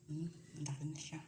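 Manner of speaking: quick hushed whisper; soft fast whisper. Real, not acted. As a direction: breathe it so the babies stay calm. Hushed near sleeping babies.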